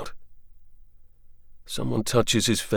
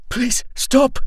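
high, louder breathless plea in pain; 7 dB louder than usual. Acted. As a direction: gasp out a plea while being dragged. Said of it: "he says breathlessly".

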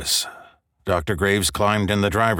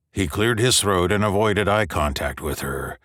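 ordinary delivery

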